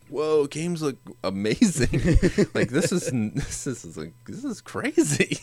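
high, awed, laughing wonder; amazed. Retold or imitated. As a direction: gush like a time traveler. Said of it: "so it's just like".

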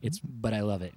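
ordinary talk

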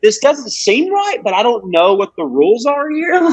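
high-pitched, bewildered protest; confused. Retold or imitated. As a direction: puzzle it out in a high, baffled voice. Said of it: "I was so confused".

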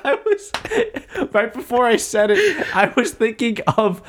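high, laughing through every word. Real, not acted. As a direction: tell it while cracking up. Telling story while laughing.

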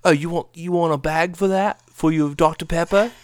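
high, loud fake British accent; hammed-up British shop clerk accent; mock British accent. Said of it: "in British accents".

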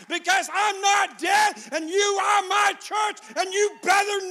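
loud, high, hammered preaching shout; full-force preaching. Real, not acted. Preacher rousing the church to come back to life, shouting. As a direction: drive each phrase home to the church.

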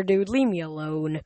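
ordinary delivery, nothing marked

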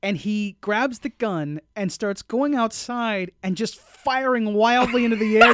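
ordinary talk

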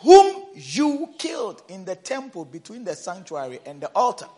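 usual speaking voice

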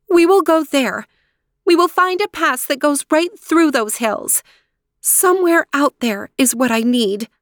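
high, eager resolve; rally your companions toward the hills; determined princess. A narrator's reading. Aurora to her animal friends, determined and high.

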